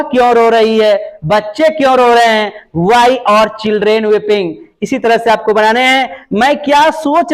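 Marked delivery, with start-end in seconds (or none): 2.8-4.5 each word slow and spaced; teacher spelling out a sentence; slow dictation. Shown as a demonstration. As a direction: dictate it slowly for the class. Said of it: "slow dictation of English".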